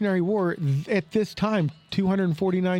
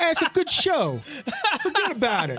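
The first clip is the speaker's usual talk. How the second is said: exuberant Boston local, hammed up; Boston accent; 9 semitones higher than usual. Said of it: "That's my, my Boston accent".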